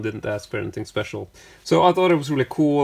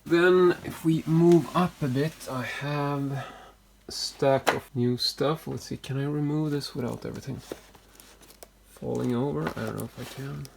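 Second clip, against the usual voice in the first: ordinary delivery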